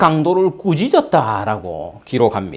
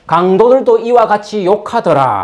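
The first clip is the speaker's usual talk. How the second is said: scripture reading; 4 semitones higher, 4 dB louder than usual. Acted. Reading scripture verse aloud.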